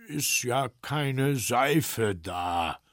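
a hint of a slow grumbling bear; drawn-out bear voice, reluctant; lightly voiced slow bear. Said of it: "sagte der Bär".

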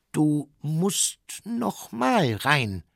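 slow, pleased dragon voice; proud. Acted. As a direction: announce it proudly, drawing it out. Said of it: "sagte der Drache stolz".

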